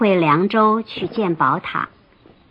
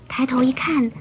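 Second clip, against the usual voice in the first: whispering; 2 semitones higher, 4 dB quieter than usual. A narrator's reading. Tense moment in flight.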